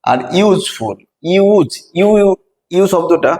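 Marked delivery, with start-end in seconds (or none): 1.3-2.3 ইউজ said "yooz", higher and louder; pronunciation demo. Shown as a demonstration. Teacher demonstrating the "yoo" sound of useful to students. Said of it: "এখানে উচ্চারণটা ইউ".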